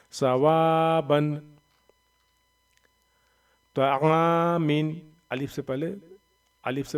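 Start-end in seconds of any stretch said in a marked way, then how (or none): 0.1-1.4 "सवाबन" with वा stretched slowly; long madd. Shown as a demonstration. Teacher showing alif madd, long वा in "सवाबन". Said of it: "एक अलिफ के ऊपर खींच कर पढ़ेंगे". 3.8-5.1 "तामीन" very slowly, first long vowel held; alif madd. Shown as a demonstration. Teacher explaining alif madd, long आ in "तामीन". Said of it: "यानी अलिफ मद्दा".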